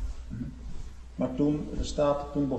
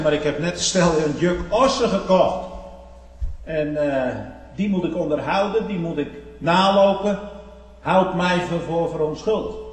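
loud, raised preacher's retelling; full-force preaching; 5 semitones higher, 7 dB louder than usual. Acted. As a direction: proclaim the excuse loudly and emphatically. Preaches the second farmer's excuse.

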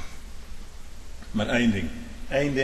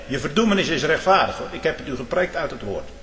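ordinary delivery

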